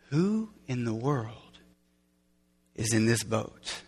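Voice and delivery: low, hushed, slow wonder; very soft, halting amazement; awed disbelief. Retold or imitated. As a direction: murmur it slowly in stunned dread. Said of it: "'Cause they're going".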